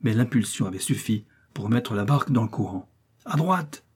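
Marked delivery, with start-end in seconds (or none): 3.2-3.8 high, urgent steering command; called-out order. A narrator's reading. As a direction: call the order out, pitch jumping up. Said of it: "cria Korzoff". (ordre crié, voix aiguë)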